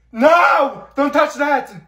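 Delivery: high, loud yell; furious shout to protect the spray; angry shout. Acted. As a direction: scream at her to keep away. Girl protects her spray can.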